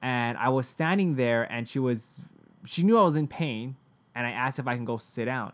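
ordinary talk, nothing marked

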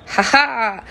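mock laugh, "Ha ha" forced out; fake loud laugh. Shown as a demonstration. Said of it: "that's how I generated that crazy loud laugh".

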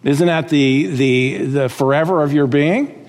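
ordinary talk, nothing marked

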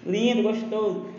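flirty teasing. Acted. Flirty joke to director.

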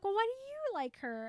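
high pitched retort; turning it back. Retold or imitated. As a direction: toss the question back in a high voice. Erin turning question back.

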